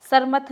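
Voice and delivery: सरमथ as sar-math, r in the first syllable; sarmath, r before m in सरमथ; wrong pronunciation. Shown as a demonstration. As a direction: put the r early in सरमथ. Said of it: "नहीं होता है".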